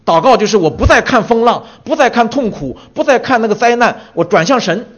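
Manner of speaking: loud, high preaching build to turning to God; fervent preaching. Acted. Preacher urging the congregation, fervent loud preaching. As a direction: proclaim it with full force.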